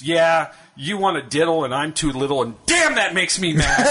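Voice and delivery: hammed-up little-boy tantrum for the punchline; mock angry boy. Marty answering his teacher in a joke, mock angry.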